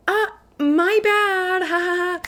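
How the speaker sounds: cutesy high-pitched oops with a little laugh; adorable Aruha. Retold or imitated. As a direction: sound sweetly guilty and adorable. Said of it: "Aruha looks so adorable when he's like".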